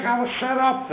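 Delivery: high put-on wail; mock protest. Charlie against the police car, high mock protest.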